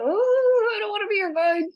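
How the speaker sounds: very high comic wail of protest; long high "oh", then a playful whine; mock reluctance. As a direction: play a guide begging off the job. Voicing reluctant guide, mocking whine.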